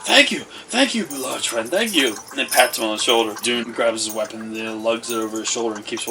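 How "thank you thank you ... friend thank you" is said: high, loud excited gratitude; effusive thanks. Acted. Storyteller to Dune for coins in his jar, effusive thanks. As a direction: gush thanks in a raised voice.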